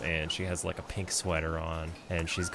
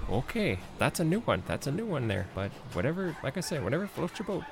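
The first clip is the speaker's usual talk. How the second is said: high, lilting mom voice; bemused southern mom; 8 semitones higher than usual. Acted. Mom reacting to guest eating plates, bemused. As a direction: politely go along with the odd guest, pitched up. Game master plays Jimmy's mom.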